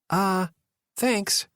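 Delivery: flat robot voice, slight curiosity; robot voice with a hint of curiosity. A narrator's reading. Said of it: "Again with the robot voice".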